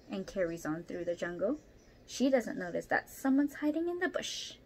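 ordinary delivery, nothing marked

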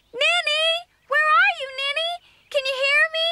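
worried calls for a lost friend; calling out. Acted. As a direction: shout her name into the woods. Searching, calling for Ninny.

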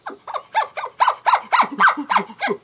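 very high, loud chuckling call; high rapid bull elk chuckle. Shown as a demonstration. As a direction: let out an ape-like bull chuckle. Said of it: "a chuckle is more of a".

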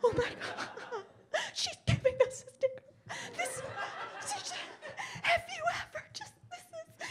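high, squeaky gasping near-sob; imitating a stunned fan, very high and breathless; overwhelmed mock amazement. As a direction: gasp and squeal as if moved to tears. Imitates overwhelmed reaction to sticker.

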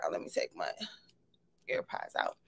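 soft unvoiced whisper to herself; whispered aside. Real, not acted. Host whispering to herself while pulling her AirPods out. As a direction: murmur it off-mic, breath only.

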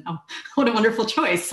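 ordinary talk

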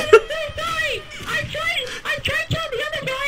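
high squeaky chipmunk voice; squeaky sped-up chipmunk voice. Real, not acted. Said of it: "You sound like a chipmunk on crack".

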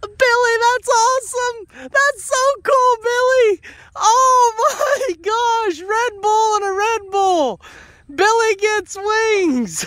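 high, slow, gushing praise with laughs; thrilled. Real, not acted. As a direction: squeal with delight at the goat. Goat does a trick, thrilled praise.

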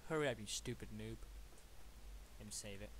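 normal talking voice

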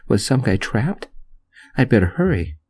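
usual speaking voice

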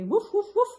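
high, loud woofs; dog bark. Retold or imitated. Grandma playing the dog for the grandkids, high loud barking. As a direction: bark brightly like a playful puppy. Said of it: "I say".

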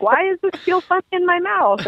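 high-pitched retold bewilderment; puzzled. Diner finding something alive in the mouth, high puzzled voice. Retells puzzled reaction to live food.

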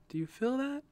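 high, bright smiling question. A narrator's reading. She feels the sun returning, smiling. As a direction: let the smile into the question. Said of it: "she smiled".

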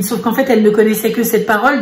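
usual speaking voice

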